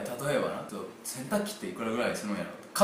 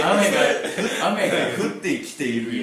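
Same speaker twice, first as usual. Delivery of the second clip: 雨が降ってきているよ laughed out, louder; laughing repeat; 3 semitones higher, 8 dB louder, slower than usual. Retold or imitated. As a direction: echo the line while cracking up. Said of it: "何それ？".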